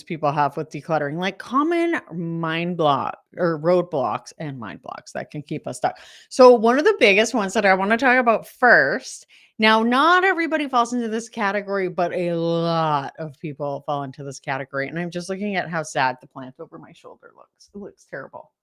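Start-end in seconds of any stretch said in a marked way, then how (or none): none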